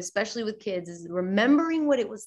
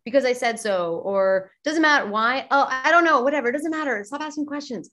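impatient parent; 4 semitones higher, 4 dB louder than usual. Retold or imitated. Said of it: "adults just say".

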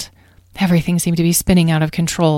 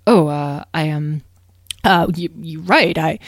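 hesitant, bumbling reply; flustered stammerer. Acted. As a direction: search for words and lose them.

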